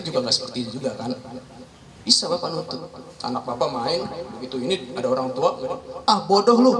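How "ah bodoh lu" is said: "bodoh lu" diucapkan lebih keras, merendahkan; tiruan hinaan kasar. Retold or imitated. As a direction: hina anak orang dengan ketus. Orang tua lain menghina anak. (orang tua lain menghina anak dengan keras)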